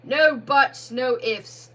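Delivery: thundering. Acted. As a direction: Badger thunders at the ghost. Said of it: "thundered the badger".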